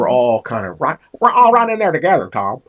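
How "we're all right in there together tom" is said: lightly voiced chatty older lady, higher and louder; a hint of her big, outgoing voice; quick, high and loud, then dropping on "Tom"; her way of talking. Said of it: "she talked like that right there".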